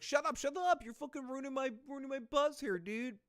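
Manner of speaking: hammed-up shrill yell telling the crowd to shut up; mock high-pitched shout. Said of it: "And she's like".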